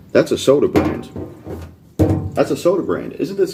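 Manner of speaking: gotcha, that's soda; excited. Real, not acted. Catching rival's contradiction.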